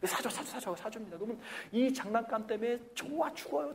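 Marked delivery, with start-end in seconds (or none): 0.1-0.8 embarrassed parent. Retold or imitated. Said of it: "너무너무 창피해가지고".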